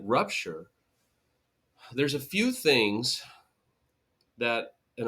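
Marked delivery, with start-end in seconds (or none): none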